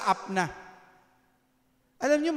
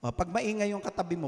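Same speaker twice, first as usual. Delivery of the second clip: usual speaking voice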